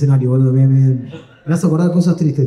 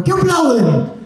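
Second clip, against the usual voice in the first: angry comedian; 9 semitones higher, much slower than usual. Retold or imitated. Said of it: "el comediante que se enoja".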